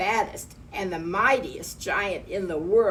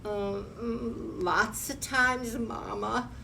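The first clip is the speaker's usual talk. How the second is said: whimpering giant; 4 semitones higher, slower than usual. A narrator's reading. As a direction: admit it meekly to Mama. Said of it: "whimpered the giant".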